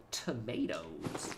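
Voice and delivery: slow, soft American "Tomatoes"; mock American accent. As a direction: draw out "Tomatoes" with an American "may" vowel. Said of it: "It's a beautiful accent".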